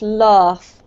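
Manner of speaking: "laugh" with a long ah; elongated vowel. Shown as a demonstration. Teacher correcting Carmen's vowel, "laugh" with elongated ah. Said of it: "elongate this vowel sound a little bit more".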